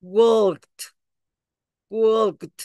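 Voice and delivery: "walked" as one syllable, final T; "walked" ending in T. Shown as a demonstration. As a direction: close "walked" on a T.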